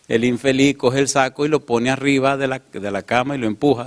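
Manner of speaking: usual speaking voice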